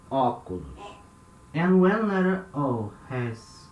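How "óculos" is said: óculos with an open first O. Shown as a demonstration. Teacher to class, modelling óculos with open O. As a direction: start óculos with a wide open O. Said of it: "this sound O".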